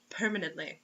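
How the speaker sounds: ordinary delivery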